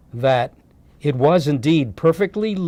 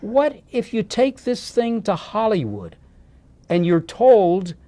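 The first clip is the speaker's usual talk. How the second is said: wife arguing; 7 semitones higher than usual. Retold or imitated. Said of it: "She said".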